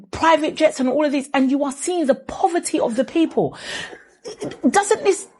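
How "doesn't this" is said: laughing, words failing; flustered laugh. Real, not acted. Flustered speaker laughing mid-rant. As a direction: laughs as the words won't come. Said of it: "I can't even speak".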